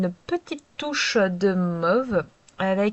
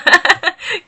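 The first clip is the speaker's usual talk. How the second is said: brief high laugh at herself; short laugh; 10 semitones higher, 8 dB louder than usual. Real, not acted. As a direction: laugh it off, amused. Laughs at her own accent. (petit rire moqueur envers soi-même)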